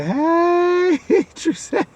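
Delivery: very high, loud drawn-out hey with a laugh; a hammed-up drunk shout from coworkers; rowdy drunken greeting. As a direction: bellow it like a tipsy buddy. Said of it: "It was like".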